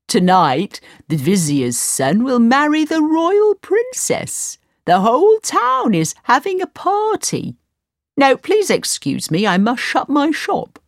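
high, slow, eager shopkeeper; a bustling seller in a hurry to close; party news, told in a rush; oil seller voice. Acted.

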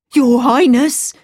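loud excited servant; excited cry. Acted. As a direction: call out with joy. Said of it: "she cried".

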